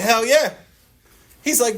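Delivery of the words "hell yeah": high, loud eager hell yeah; cocky hell yeah. Retold or imitated. A salesman brashly answering his boss, high and loud. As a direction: fire it back proudly. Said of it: "And I was like".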